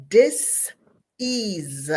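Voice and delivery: "dis-ease" slowly broken in two, DIS, pause, EASE; split word. Shown as a demonstration. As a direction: pull "dis-ease" apart into "dis" and "ease". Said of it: "D I S, a dash, E A S E".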